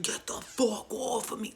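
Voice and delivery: rough, growled mock screamo; imitated demonic man voice. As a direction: growl it like a possessed girl. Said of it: "with a man voice".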